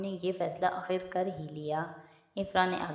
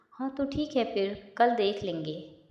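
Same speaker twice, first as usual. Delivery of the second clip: plain reading voice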